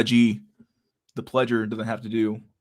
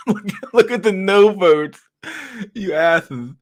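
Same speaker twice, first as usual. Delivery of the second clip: loud, high and drawn out; slow, high, amused exclamation; laughing outburst; 11 semitones higher, 4 dB louder, much slower than usual. Real, not acted. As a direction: cry it out through real laughter. Laughing at the no votes.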